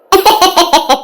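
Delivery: a short chuckle at the question; amused laugh. Acted. Woman laughing at a silly game suggestion. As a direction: laugh it off lightly. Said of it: "என்னக்கா சிரிக்க?".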